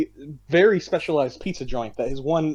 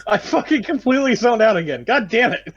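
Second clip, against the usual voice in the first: pitched-up exasperated swearing; annoyed groan at yourself; frustrated outburst; 6 semitones higher than usual. Real, not acted. Frustrated at missing the word.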